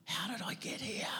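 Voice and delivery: a lost whisper to yourself. Retold or imitated. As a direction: whisper it, breathy and unvoiced. Same question loud then whispered.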